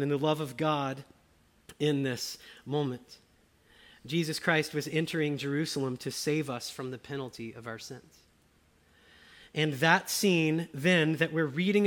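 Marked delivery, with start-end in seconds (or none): none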